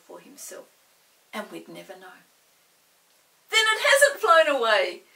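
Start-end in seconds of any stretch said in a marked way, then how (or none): 3.5-5.0 relieved excitement. A narrator's reading.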